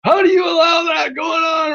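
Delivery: very high, whining complaint; whiny troll impression. Streamer mocking the banned troll's whining. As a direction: whine it up high like a sulking kid. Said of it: "he's whining to Armando".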